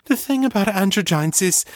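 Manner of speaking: rushing to explain; urgent and shaky. A narrator's reading. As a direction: Ava warning Danny. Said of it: "urgent and shaky".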